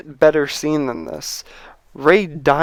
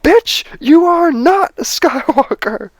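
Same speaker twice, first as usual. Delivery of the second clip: much higher, slow furious shriek; hammed-up angry scream, slowed and pitched way up; mock ghost screaming; 12 semitones higher than usual. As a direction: scream it down at her like an enraged Force ghost. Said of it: "starts screaming at her, going".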